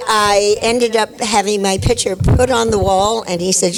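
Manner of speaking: ordinary talk, nothing marked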